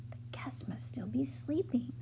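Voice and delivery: soft unvoiced whisper; whispering to herself in an empty lobby; whispered thought. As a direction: breathe it like a secret thought. Mona's private thought, whispered.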